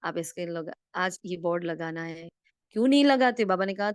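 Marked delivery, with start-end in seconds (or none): 2.7-3.4 louder, higher scolding question; emphatic challenge. Acted. Teacher urging students to put up the board, emphatic challenge. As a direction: demand it, pitched up and forceful.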